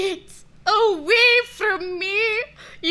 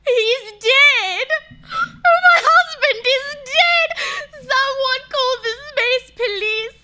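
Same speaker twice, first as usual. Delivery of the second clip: tearful, wailing cries; hysterical grief. Acted. Widow crying out over her dead husband, hysterical. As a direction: wail it out through sobs.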